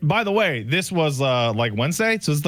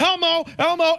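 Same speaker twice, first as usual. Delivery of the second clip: calling out; 12 semitones higher than usual. Retold or imitated. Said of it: "we were like".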